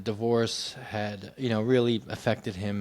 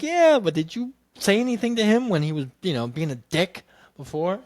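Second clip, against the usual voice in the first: higher, louder pointed comeback; higher, sharper retort to his mother; indignant retort; 7 semitones higher, 4 dB louder than usual. Retold or imitated. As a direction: call her out, half-joking.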